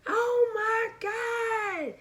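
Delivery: high, laughing, tearful squeal; mom's excited shriek. Retold or imitated. Mom hears twins news, excited high squeal. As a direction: squeal it like a thrilled mom on the phone. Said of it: "I could just hear it through the phone".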